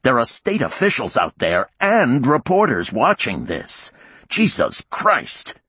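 furious. Acted. Warden furious at crew.

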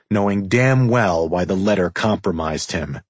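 ordinary delivery

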